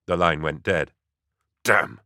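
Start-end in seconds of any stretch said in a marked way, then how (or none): none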